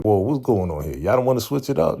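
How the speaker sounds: acting out alarmed objection, pitch climbing; taken-aback protest. Professor balking at his new spades partner, taken-aback protest.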